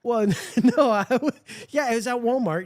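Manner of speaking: real laughter breaking into the answer; chuckling while admitting where it happened; laughing. Real, not acted. Laughing about the random invite.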